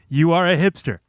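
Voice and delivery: ordinary delivery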